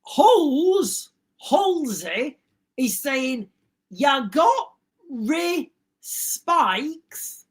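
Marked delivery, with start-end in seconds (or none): 3.9-7.4 very slow, halting word-by-word reading; puzzled sounding-out. Real, not acted. Host puzzling out a viewer's garbled comment, slow and halting. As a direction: read it haltingly, one word at a time.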